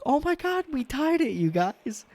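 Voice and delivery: mocking squeal of a normal fan; mock happy fan. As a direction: gush like a giddy ordinary fan. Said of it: "most fans should be like".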